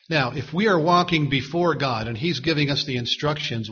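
ordinary talk, nothing marked

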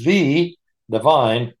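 "the" said slowly and set apart as "thee"; stress on THE. Real, not acted. Teacher stressing the definite article to the group, stress on "the". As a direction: lean on "the" as a long "thee". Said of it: "that's the, uh, definite article".

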